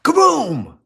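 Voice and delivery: loud high kaboom shout; jump-scare yell; startling boo. Acted. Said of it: "Did I scare you".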